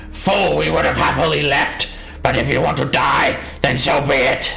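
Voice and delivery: high-pitched creature yelling; gnoll yell. Acted. Gnoll threatening the party as weapons are drawn, high yell. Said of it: "the gnoll sort of yells at you".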